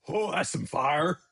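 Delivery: slow, wide-eyed ancient onlooker; naive onlooker. Retold or imitated. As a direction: drawl it like a baffled ancient Hebrew. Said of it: "They're gonna look and say".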